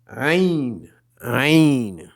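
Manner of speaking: guttural "Aïn" between nose and mouth; Arabic letter Ain. Shown as a demonstration. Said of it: "difficile à décrire".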